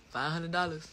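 usual speaking voice

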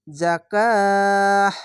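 slow chant, last vowel held three beats long; Quran recitation. Shown as a demonstration. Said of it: "তিন আলিফ টেনে পড়লে মাদ্দে আরজি হয়ে যায়".

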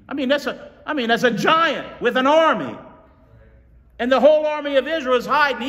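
high, loud preacher's build; preaching at full force. Real, not acted. Preacher stirring the congregation, high and loud. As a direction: drive the story home to the church.

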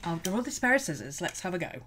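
quietly resigned let's have a go. Retold or imitated. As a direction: settle for it half-heartedly. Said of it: "look at something and go".

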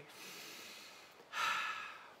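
long, soft exhaled sigh; slow audible out-breath; demonstrated sigh. Shown as a demonstration. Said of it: "Sighing is something always available".

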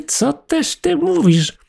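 mouse can't believe it; astonished exclaim. A narrator's reading. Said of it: "zawołała mysz".

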